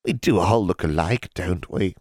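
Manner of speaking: put-on teasing old witch voice; hag voice. A narrator's reading. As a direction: tease her like a knowing crone. Hag teases Alexandra.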